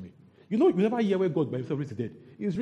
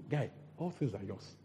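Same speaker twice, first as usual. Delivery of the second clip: gentle father; 5 semitones lower, 8 dB quieter than usual. Acted. Father answers the elder brother.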